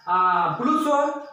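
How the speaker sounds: normal talking voice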